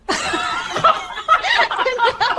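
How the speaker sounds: high, helpless burst of laughter; uncontrolled high laughing fit; long laugh. Real, not acted. Reacting to the parrot's joke.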